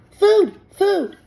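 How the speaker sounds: put-on high, loud baby-bird peep; a hungry hatchling calling out; tiny gosling voice. A narrator's reading. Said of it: "That tiny voice".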